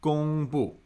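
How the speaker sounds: 公布 with level 公, falling 布; teacher's clear tone model of 公布; first and fourth tones. Shown as a demonstration. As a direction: keep 公 flat, then drop 布. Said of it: "First and fourth".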